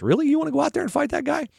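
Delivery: incredulous, high and quick; King Saul. Retold or imitated. King questioning young David, incredulous. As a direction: question him in high, rushed disbelief. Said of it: "he calls David in".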